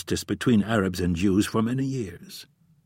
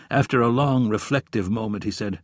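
usual speaking voice

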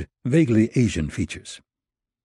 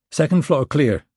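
radio report; 7 semitones higher, 3 dB louder than usual. Acted. Reporting into wrist microphone.

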